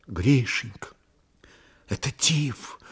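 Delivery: soft, faint near-whisper; hushed, weak. A narrator's reading. Sick woman tells her man what she fears, hushed. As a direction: breathe it out quietly, pausing between words.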